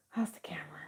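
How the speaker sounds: whispered aside. Real, not acted. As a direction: breathe it under your breath. Aside to herself.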